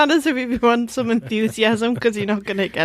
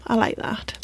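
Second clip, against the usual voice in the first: whispering; 6 dB quieter than usual. Real, not acted. Whispered aside.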